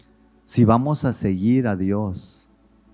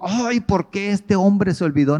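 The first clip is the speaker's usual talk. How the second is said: emphatic mock lament; 10 semitones higher than usual. Real, not acted. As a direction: throw out the whiny question. Mock complaint rejected.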